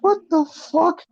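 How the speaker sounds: very high-pitched disbelief; re-enacted shock, voice shooting up; incredulous reaction. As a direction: squeal it in baffled amazement. Replays own baffled reaction to commentary.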